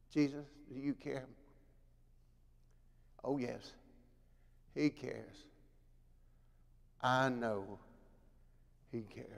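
soft, low, tender assurance; preacher softly assuring the congregation that Jesus cares; quiet reassurance. Real, not acted. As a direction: lean in and comfort them gently. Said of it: "quiet reassurance after hymn".

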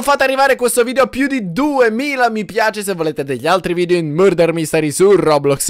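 ordinary talk

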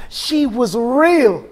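a defiant proclaimed shout. Acted. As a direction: stretch it out and shout it. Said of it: "And I breathe to shout".

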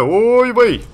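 loud, high-pitched wail of alarm; drawn-out yelp of dismay; dismayed outcry. Real, not acted. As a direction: cry it out, soaring high. Exclaims at trouble in game.

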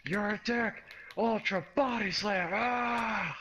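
high frantic screaming. Acted. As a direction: scream it out in a panic. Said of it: "He's screaming".

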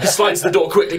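ordinary talk, nothing marked

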